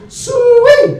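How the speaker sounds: very high, loud hog call. Retold or imitated. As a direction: holler like calling pigs. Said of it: "you get".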